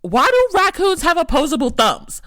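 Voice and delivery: high, loud startled cut-off cry; a jolt of fright at the raccoon hand; shocked outburst. Retold or imitated. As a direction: blurt it out in sudden alarm. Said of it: "And I was like".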